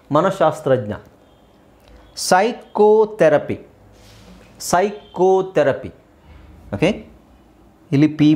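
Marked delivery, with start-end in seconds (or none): 2.3-5.9 ಸೈಕೋಥೆರಪಿ slowly, with sai and a long koo set apart; silent P demo. Shown as a demonstration. Teacher explains only P is silent, ಸೈಕೋಥೆರಪಿ slowly drawn out. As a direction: draw out ಸೈಕೋಥೆರಪಿ, starting on S with no P. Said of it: "ಪಿ ಮಾತ್ರ silent ಆಗಿರೋದು".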